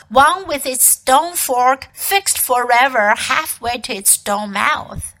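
usual speaking voice

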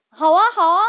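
loud, bright shout of delight; excited cheer. A narrator's reading. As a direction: cheer like a crowd clapping. Flying fish cheer excitedly.

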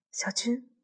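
softly calling his name, barely voiced; gentle soft call. A narrator's reading. Woman softly calls to Xiaojun standing at the window, gentle and hushed. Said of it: "我轻轻地叫他".

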